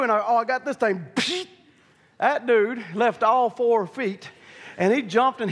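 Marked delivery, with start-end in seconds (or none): 1.1-1.5 short high hissed zap; imitated zap. Man using the hot shot on the bull, imitated zap. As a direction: mimic a sudden electric jolt.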